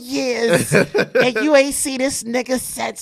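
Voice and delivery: hammed-up mimicry of a complaining mother; mocking nagging woman. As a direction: lampoon her list of complaints, squealing through laughs.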